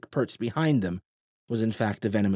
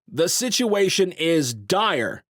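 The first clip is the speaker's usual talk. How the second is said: loud, slow, over the crowd; nearly shouting; 8 semitones higher, 5 dB louder, slower than usual. A narrator's reading. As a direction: project firmly over the murmurs. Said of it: "nearly shouting now to be heard".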